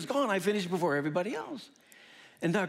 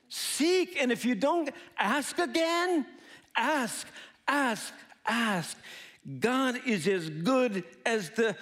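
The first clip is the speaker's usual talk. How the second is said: high, punchy exhortation in short bursts; emphatic preaching; 6 semitones higher, slower than usual. Real, not acted. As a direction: urge the congregation, word by word. Preaches the point to the congregation.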